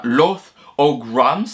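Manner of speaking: French speaker's attempt at an English accent; would-be English accent. Real, not acted. Vlogger naming Disney's first company, would-be English accent. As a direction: say the English name with a homemade English accent. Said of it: "mon super accent anglais".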